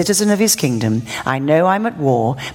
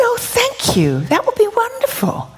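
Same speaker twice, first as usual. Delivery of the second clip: much higher, gentle grateful voice; sweet young man. Retold or imitated. Preacher voicing the grateful young man, high and gentle. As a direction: lift the voice up high for the sweet boy.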